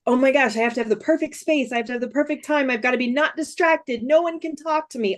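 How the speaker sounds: anxious perfectionist. Retold or imitated. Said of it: "so many people feel like".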